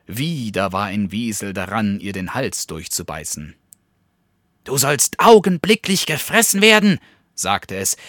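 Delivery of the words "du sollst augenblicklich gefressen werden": high, loud, fast threat; threatening weasel. A narrator's reading. Second weasel threatening to eat the bat, high loud and fast. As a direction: bark it at your prey.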